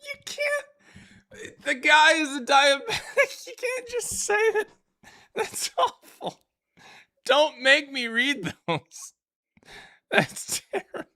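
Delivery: very high, squeaky laughing speech; helpless laughter. Real, not acted. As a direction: protest through a real fit of laughter. Cracks up at own reading.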